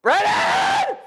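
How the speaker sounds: very high, loud mock mom screech; imitated exasperated mother's yell. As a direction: holler the name up the stairs. Mother yells for brother downstairs.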